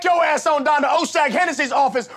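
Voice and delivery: furious high shout; enraged boss roar. Acted. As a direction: explode in a screaming rage. Teacher explodes at student.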